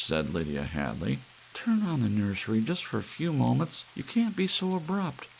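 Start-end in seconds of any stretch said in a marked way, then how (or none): 1.5-5.3 lightly voiced mother, higher and pleading. A lightly voiced wife pleading with George to relent. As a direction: beg your husband gently but urgently.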